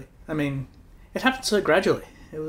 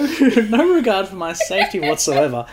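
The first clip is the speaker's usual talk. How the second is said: loud mock-outraged complaint, higher and breaking into laughs; laughing indignation; 5 semitones higher, 9 dB louder than usual. Real, not acted. Partner scolding partner for leaving them with spirits, laughingly indignant. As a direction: protest loudly through laughter. Teasing complaint about being abandoned.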